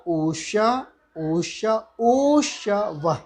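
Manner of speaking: ऊष्य with the u held longer each time; drawn-out u sounds. Shown as a demonstration. Teacher demonstrating vowel length, u held longer. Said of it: "एक मात्रा".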